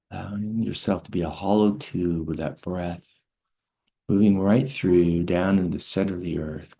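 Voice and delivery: calm teacher guiding a breathing exercise; guided breathwork. Acted. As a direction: lead them gently through each breath.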